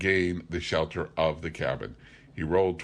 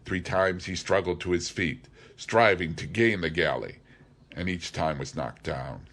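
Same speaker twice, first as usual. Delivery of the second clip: usual speaking voice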